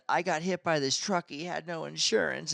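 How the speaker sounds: high, tearful pleading imitation; put-on whimpering complaint; mock crying. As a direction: mimic a weepy sob story. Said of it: "she's crying, and she's saying".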